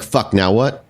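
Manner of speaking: ordinary talk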